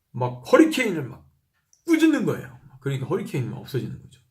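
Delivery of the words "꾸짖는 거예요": emphatic rebuke. Real, not acted. 폭풍을 꾸짖는 장면 강조.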